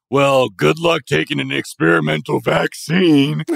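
high, loud mocking whine; mocking anti-vaxxer. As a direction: sneer it like a skeptic. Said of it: "the whole, like".

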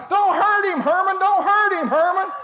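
high, loud, frantic pleading yell; acted mother screaming to stop her husband; hollering mama. Mama begs Herman not to hurt the boy, high hollering. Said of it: "Mama's hollering".

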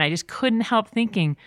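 usual speaking voice

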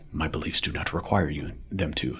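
low, hushed, solemn impression; Morpheus. Replying to Commander Locke as the movie character, in Morpheus voice. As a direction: drop deep and quiet, measured movie-mentor delivery. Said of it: "that was my Morpheus voice again".

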